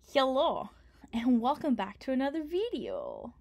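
loud, bright channel-host opener; youtuber intro. Acted. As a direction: greet your viewers like the show just started. Starts recording his cooking video.

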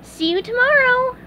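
very high, bright cartoon farewell; squeaky delighted sponge; cheery sponge goodbye. A narrator's reading. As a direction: wave goodbye happily.

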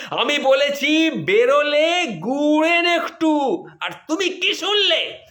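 hammed-up furious wife voice; mock shouting wife. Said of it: "অমনি আরো দ্বিগুণ চিৎকার".

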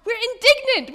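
high, loud, playful shout of outrage; hammed-up cry of betrayal; acting out the other disciples rounding on James and John, mock indignant shout; mock indignant outburst. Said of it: "the other disciples were like".

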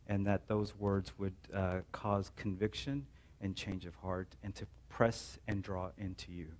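low, quiet pastor's prayer, unhurried. Acted. Pastor praying over the church before preaching, hushed and earnest. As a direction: pray humbly over the congregation.

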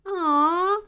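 high, loud, drawn-out "aw"; exaggerated storybook disappointment; disappointed sigh. A narrator's reading. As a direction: sigh like let-down children. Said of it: "sighed the children".

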